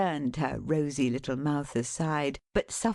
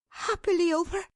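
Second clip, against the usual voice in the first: eager; 13 semitones higher than usual. Acted. Dot questioning.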